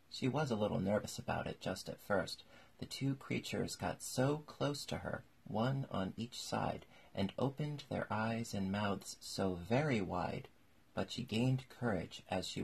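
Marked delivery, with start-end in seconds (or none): none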